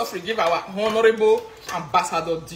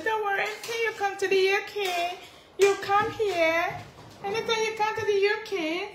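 mock phone voice; 12 semitones higher, 4 dB quieter than usual. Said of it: "Wey dey talk under, say".

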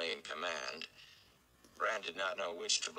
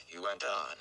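ordinary delivery, nothing marked

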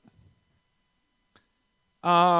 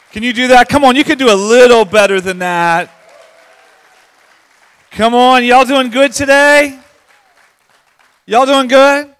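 loud, higher rallying shout; rousing crowd call; 3 semitones higher, 13 dB louder than usual. Real, not acted. Pastor urging the congregation to clap better, rousing shout. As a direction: call out big to pump up the room.

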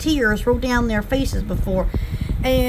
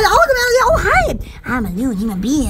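ordinary delivery

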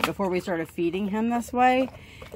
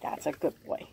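whispered praise to the eating dog; soft praise; 3 semitones lower, 11 dB quieter than usual. Real, not acted. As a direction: murmur praise softly.

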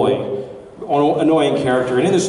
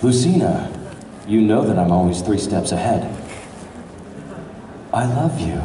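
nervous trembling love confession; 7 semitones lower than usual. Pretend. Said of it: "Can you do Robin confessing his love to Lucina?".

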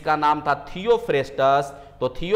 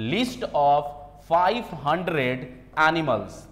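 slow dictation; much slower than usual. Shown as a demonstration. Teacher dictating key fact to students.

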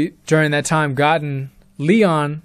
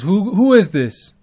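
higher, louder retold challenge; startled questioning; 6 semitones higher, 4 dB louder than usual. Marcus questioning the strange caller, startled and raised in pitch. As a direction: imitate someone thrown by an unknown caller.

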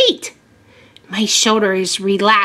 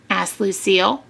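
ordinary delivery, nothing marked

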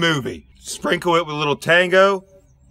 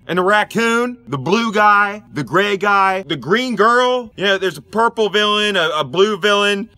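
ordinary delivery

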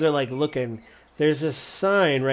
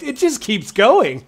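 higher, louder amazement; blown away; 8 semitones higher, 6 dB louder than usual. Pretend. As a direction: marvel at it, voice lifting. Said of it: "you're kinda blown away".

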